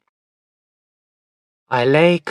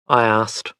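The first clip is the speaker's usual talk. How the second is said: usual speaking voice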